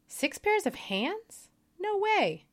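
can't believe the number; incredulous. Acted. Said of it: "The angel shook her head slowly and said".

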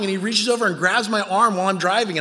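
usual speaking voice

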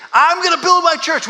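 pumped-up rally cry; proclaimed loudly. Pretend. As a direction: shout it to the crowd. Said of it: "he wasn't here saying".

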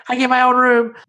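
put-on squeaky high girl voice on demand. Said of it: "A little higher".

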